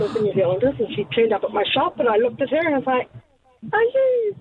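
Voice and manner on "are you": surprised. Retold or imitated. Recognising old schoolmate in Antarctica.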